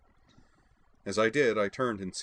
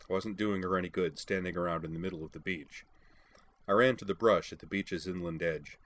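ordinary delivery

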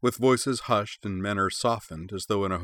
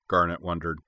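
ordinary delivery, nothing marked

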